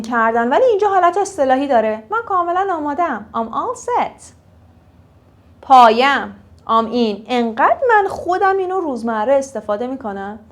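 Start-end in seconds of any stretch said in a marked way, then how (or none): none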